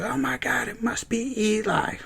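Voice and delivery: hammed-up shock; mock gasp. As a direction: gasp like a dumb audience. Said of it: "Everyone's like".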